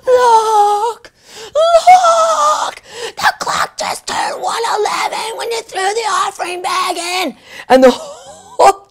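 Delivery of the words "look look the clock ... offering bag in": shouted, much higher, crying out; going crazy. Retold or imitated. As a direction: yell it at the whole church in joy. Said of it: "I go crazy".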